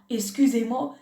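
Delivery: usual speaking voice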